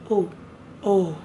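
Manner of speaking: ordinary talk, nothing marked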